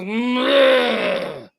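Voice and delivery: a loud put-on groan of someone half asleep; mock sleepy grunt. Husband mimicking his sleeping wife's loud grunt. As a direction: groan it out like a woman woken too early. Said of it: "And she goes".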